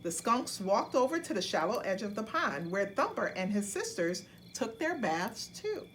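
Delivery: ordinary delivery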